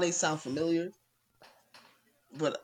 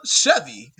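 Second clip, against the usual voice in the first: ad announcer; 3 semitones lower, 8 dB louder than usual. Retold or imitated. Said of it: "Be like".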